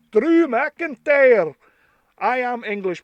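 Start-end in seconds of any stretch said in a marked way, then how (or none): 0.1-1.5 slow, louder attempt at Scottish; rough mock Scottish accent. English fan naming the wrestler, imitation Scottish accent. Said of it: "should be a much better Scottish accent".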